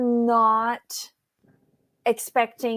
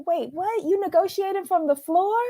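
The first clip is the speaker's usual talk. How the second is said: incredulous; 7 semitones higher than usual. Retold or imitated. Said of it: "I was like".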